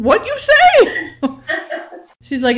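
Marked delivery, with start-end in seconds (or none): 0.0-2.2 much higher and slower, laughing through it; laughing mock confusion. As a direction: pretend not to have heard, cracking up. Playing him mishearing her.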